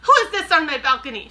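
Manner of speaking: suspicious witch peering out; muttered witch line. A narrator's reading. Grand High Witch muttering at someone on her balcony. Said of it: "she muttered".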